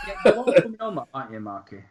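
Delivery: fast, giggling jab; laughing tease. Real, not acted. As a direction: tease him through laughter. Teasing guest, laughing.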